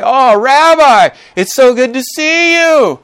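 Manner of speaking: high, loud, drawn-out gushing welcome; gushing greeting. Acted. Marketplace crowd greeting a Pharisee, gushing high and loud. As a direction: fawn over the rabbi, stretching it out high.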